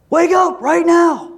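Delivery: high, drawn-out mock yell. Someone yelling at you to wake up, comic yell. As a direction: jolt a sleeper awake with a yell. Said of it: "someone yelling at you".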